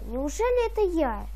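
ordinary talk

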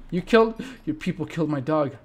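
usual speaking voice

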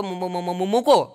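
stuck on the first syllable; a stammering caller who cannot get the word out. Retold or imitated.

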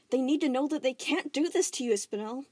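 determined. Acted. Steven assuring Spinel, determined. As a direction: promise her firmly.

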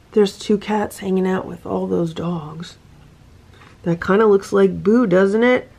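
plain reading voice

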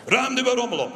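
put-on firm order to a child; stern dad. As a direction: repeat the order firmly. Father ordering again.

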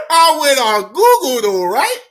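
high, loud squeal through laughter; helpless amusement. Real, not acted. Storyteller confesses an embarrassing search, helplessly amused. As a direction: struggle to get it out, laughing.

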